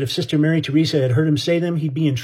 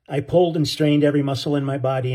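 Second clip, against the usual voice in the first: ordinary delivery, nothing marked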